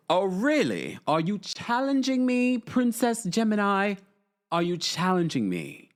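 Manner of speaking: high, mock-offended English accent; put-on English accent. Answering a viewer's challenge, in a mock-offended English accent. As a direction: act affronted as a proper pretend Brit. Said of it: "You can't keep this up the whole live".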